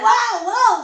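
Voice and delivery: re-living the moment she learned she was selected; joyful whoops. Retold or imitated. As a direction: whoop like a dream just came true. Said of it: "我就开始高兴".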